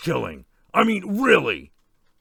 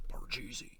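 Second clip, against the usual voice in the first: breathy whisper, much softer; whispered game name; 19 dB quieter than usual. Retold or imitated. Jigsaw naming the game to the slasher, whispered. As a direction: whisper the answer like a secret.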